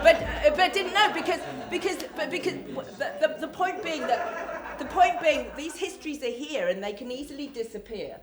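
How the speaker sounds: speaking through laughter; laughing while talking. Real, not acted. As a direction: laugh your way through the words. Laughing through his point.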